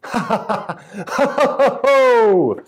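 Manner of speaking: high, loud acted belly laugh; hearty storybook laugh, building to a long falling hoot; jolly chuckle. A narrator's reading. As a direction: burst into a happy, hammed-up chuckle. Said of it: "a really jolly, happy chuckle".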